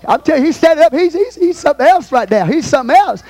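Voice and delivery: fired-up preaching. Real, not acted. Preacher marvelling at the apostle, fired-up and high. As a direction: exclaim it high and forcefully.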